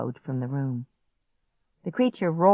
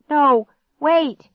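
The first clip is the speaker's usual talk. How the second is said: high, loud, stretched cries; desperate scream; 11 semitones higher, 7 dB louder, much slower than usual. A narrator's reading. Girl screams after departing grandparents, desperately. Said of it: "I screamed".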